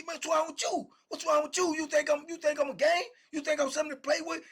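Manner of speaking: high-pitched, confrontational imitation; barking at him. Bully walks down on his cellmate, barking angry challenges. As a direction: bark it down on him as he backs up. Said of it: "he barking down on him".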